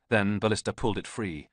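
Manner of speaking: ordinary delivery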